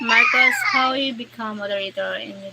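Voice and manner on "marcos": much higher, louder shout of the name; calling out. Real, not acted. Streamer calling out to a moderator, loud and high. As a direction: yell his name to grab attention.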